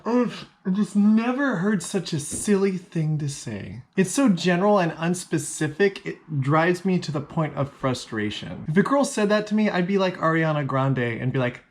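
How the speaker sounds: ordinary talk, nothing marked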